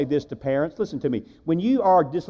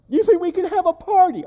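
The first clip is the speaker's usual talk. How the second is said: put-on high childlike voice, much higher; a small boy perking up through tears; little boy voice; 12 semitones higher than usual. Little boy asks his dad, high childlike voice.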